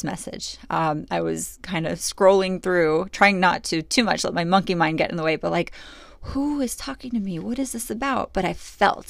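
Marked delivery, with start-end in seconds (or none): 6.2-8.3 higher, puzzled inner voice; wondering who is speaking; bewildered. Retold or imitated.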